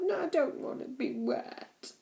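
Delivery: put-on slow, high little-boy whine; mild wail. Max protests to Fred about being soaked, slow mild whine. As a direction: drag it out like a sulky child. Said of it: "he wailed".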